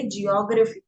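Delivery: elongated first syllable; stretched ji. Shown as a demonstration. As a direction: stretch the ज in "जियोग्राफी". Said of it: "ज पे हम stretch करेंगे".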